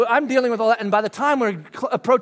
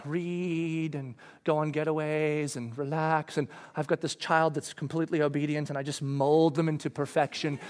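dreamy wish; 6 semitones lower, 8 dB quieter than usual. Retold or imitated. Wife picking a calm life: soft, dreamy. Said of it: "But Brooke would go like".

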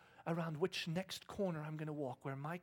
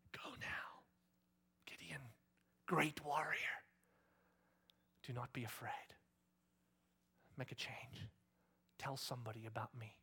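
hushed stage whisper, with long pauses; God whispering. Acted. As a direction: whisper it as a solemn urging, phrase by phrase. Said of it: "as he whispers to me".

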